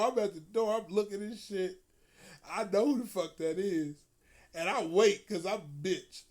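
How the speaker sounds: usual speaking voice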